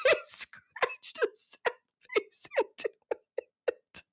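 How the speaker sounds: very high, slow, laughing through; squeaky laughter. Real, not acted. Laughing at the toast.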